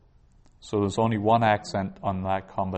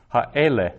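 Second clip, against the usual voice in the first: Ha'ele with E stressed, louder, then falling; stress on second-last syllable; 3 semitones higher, 6 dB louder than usual. Shown as a demonstration. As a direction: hit the middle E in Ha'ele. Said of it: "accent is on the second last syllable".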